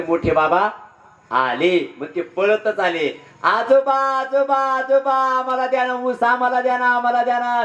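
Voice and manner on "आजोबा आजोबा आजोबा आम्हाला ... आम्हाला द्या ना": high, sustained pleading cries; children clamouring. Acted. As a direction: call out like excited grandchildren begging grandpa. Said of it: "जोरजोरात ओरडायला लागले मुलं".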